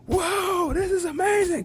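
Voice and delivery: thrilled. Retold or imitated. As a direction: burst out in amazement. Retelling thrilled inner reaction.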